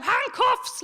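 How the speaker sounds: retold shout. Comic mimics a lady shouting from the balcony, mock shout. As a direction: imitate someone calling out from the crowd. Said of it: "she shouted out".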